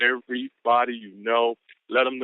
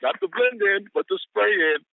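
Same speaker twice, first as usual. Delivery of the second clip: excited hype; 11 semitones higher than usual. Real, not acted. Speaker hyping his own quote twist, excited. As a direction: pump up the punchline.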